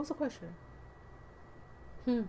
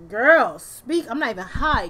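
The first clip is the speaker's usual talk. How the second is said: excited outburst; 2 semitones higher, 10 dB louder, slower than usual. Real, not acted. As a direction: cry out to the reading.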